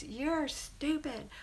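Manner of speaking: high, drawn-out angry taunts; hammed-up tantrum insults; mock mad child. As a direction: imitate a child lashing out, slow and high-pitched. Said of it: "She's just mad at herself".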